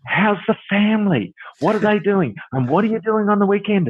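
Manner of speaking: high, gushing mock friendliness; chatty shop assistant. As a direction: imitate an over-chatty bakery server. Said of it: "build their business by chatting to you".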